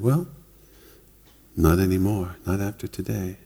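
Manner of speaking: softly, almost under the breath; quiet. Retold or imitated. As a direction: say it quietly and gently, like a promise. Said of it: "he said it that quietly".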